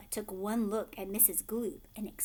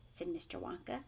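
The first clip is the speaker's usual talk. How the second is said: ordinary delivery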